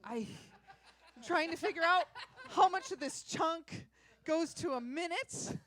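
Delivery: real laugh breaking into the words; amused at losing track of her set time; laughing through it. Real, not acted. Comic laughs at her own timing.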